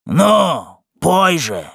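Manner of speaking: impatient tipsy yell; rough drunken shout. A narrator's reading. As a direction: bellow it like a coarse drunk. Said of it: "кричал грубый пьяный голос".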